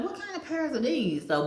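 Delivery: high, bewildered question about strange pears; baffled surprise. Retold or imitated. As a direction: ask it like the pear makes no sense. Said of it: "I'm like".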